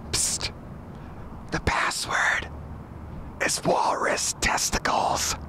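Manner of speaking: hushed conspiratorial whisper; whispering a secret password. Pretend.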